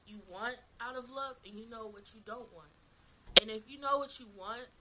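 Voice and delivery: ordinary talk, nothing marked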